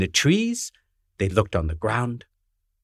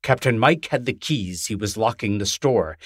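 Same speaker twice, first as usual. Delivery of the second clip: ordinary delivery, nothing marked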